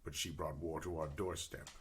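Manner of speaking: low, measured tale-telling in character; solemn war-orphan adventurer. Acted. Player tells character's backstory.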